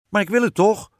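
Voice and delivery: high, loud queen protest; defiant storybook queen; insistent queen. A narrator's reading. Queen insists against the king.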